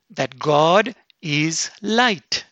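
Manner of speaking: very slow, weighty; slow emphasis. Acted. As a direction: lean on each word.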